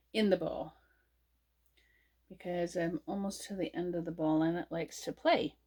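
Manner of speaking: normal talking voice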